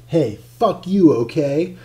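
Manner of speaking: loud, slow, snapped at the lover; angry retort. Shown as a demonstration. Narrator voicing his retort to Keith, angry. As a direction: spit it out in anger. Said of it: "All right, never mind".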